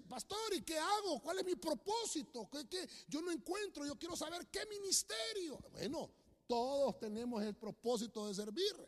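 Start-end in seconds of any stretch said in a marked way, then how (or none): 0.1-5.6 high-pitched mimicry of people pleading for guidance; mock-fretful questioner; imitating anxious churchgoers. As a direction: rattle off their questions in a raised voice. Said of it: "Hay gente que dice".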